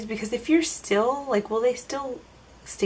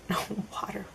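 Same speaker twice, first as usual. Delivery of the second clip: laughing whisper; 13 dB quieter, faster than usual. Real, not acted. Laughing correction.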